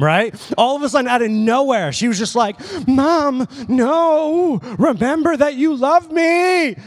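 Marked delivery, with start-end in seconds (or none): 2.9-6.7 high, loud mock-desperate child's plea; freaking out. Daughter begging mom not to trade her, imitated high freak-out. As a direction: imitate a little girl wailing to her mom. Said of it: "freaking out".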